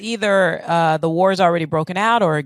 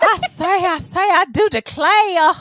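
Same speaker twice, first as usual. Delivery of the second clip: much higher, slow mock Southern lady; Southern belle accent; 11 semitones higher, slower than usual. Cohost mixes the rooster joke with a high Southern belle accent. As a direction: flutter it like a fainting belle. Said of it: "it's foghorn leghorn".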